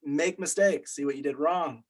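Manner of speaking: ordinary delivery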